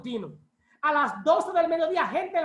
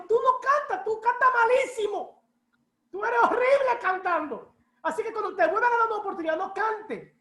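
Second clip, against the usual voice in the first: higher-pitched scolding friend; imitated blunt scolding; 6 semitones higher than usual. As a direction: tell them off bluntly, pitched up. Acts out friend's blunt criticism. (regaño imitado en tono agudo)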